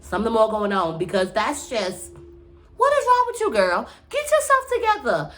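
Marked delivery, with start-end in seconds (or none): 2.8-3.9 much higher, exasperated cry; incredulous scolding. Real, not acted. Scolding cast member. 4.1-5.3 much higher, exasperated cry; incredulous scolding. Real, not acted. Scolding cast member.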